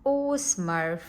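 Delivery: plain reading voice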